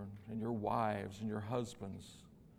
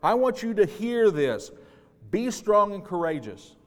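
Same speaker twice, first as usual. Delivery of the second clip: loud, higher command to Joshua; commanding shout; 9 semitones higher, 12 dB louder than usual. Acted. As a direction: order him to be strong. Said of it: "I'm commanding you".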